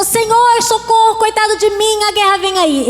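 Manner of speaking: high, pleading whine, a pretend cry for help; mock panicked plea. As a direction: whimper like a scared man begging. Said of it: "Ele não começou".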